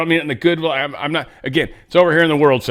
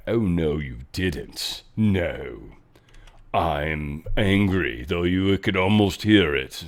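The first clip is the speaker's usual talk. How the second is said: lower, slow mock British outrage; lower, slow mock British accent; 7 semitones lower, 3 dB quieter, much slower than usual. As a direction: play the reserved Brit who is angry. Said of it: "still British".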